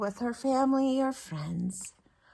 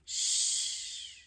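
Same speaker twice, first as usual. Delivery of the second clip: soft shushing; 4 dB quieter than usual. Acted. Bedtime story, hushing.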